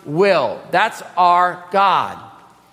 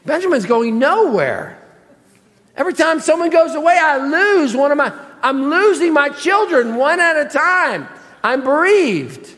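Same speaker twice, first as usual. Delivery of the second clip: exasperated, high-pitched father's protest; acted-out aggrieved parent, indignant; Jacob; 5 semitones higher than usual. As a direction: plead your losses, voice riding high. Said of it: "We could say it like this".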